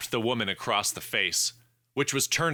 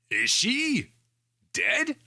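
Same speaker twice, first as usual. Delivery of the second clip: much higher, rising alarmed question; slower than usual. A narrator's reading. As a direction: ask it high and startled. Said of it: "Felix asked".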